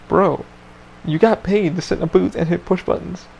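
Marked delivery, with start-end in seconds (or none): none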